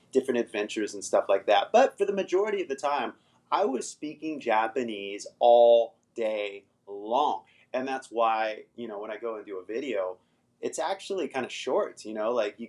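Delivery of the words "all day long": "all day long" stretched out slowly, word by word; "all... day... long" with weighty gaps; emphatic drawn-out stress. Real, not acted. Stressing how long he spoke.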